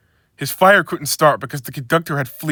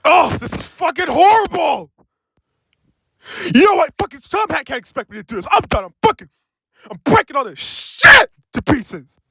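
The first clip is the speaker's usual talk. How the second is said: shrill furious engine rant; angry rant; 12 semitones higher, 3 dB louder than usual. Acted. Furious engine rants about the hard job. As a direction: rage at the boss, voice cracking high.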